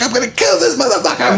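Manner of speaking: high, laughing mock rage; mom in a rage. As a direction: threaten him with a laugh in it. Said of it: "she's like".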